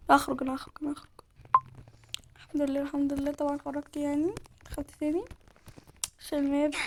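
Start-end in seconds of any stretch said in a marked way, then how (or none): none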